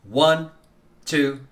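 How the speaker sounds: normal talking voice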